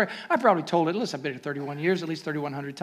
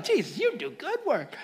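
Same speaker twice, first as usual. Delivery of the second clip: light high voice of a proud mother; a touch of a doting mother; mother in heaven, laughing; 6 semitones higher, 4 dB quieter than usual. Retold or imitated. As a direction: mom bragging to Jesus with a laugh. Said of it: "She's telling".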